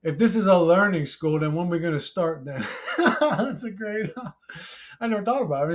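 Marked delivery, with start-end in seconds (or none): 3.0-5.7 breaking into laughter at the end; laughing. Real, not acted. Reader delighted by a viewer's joke, laughing through it. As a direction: crack up as you read it out. Cracks up rereading a joke comment.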